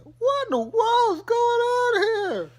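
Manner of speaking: very high, slow, loud exclaim; shocked Todd. Retold or imitated. Todd walking in on the pampering, shocked outrage. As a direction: gasp it out in outrage. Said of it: "he's like".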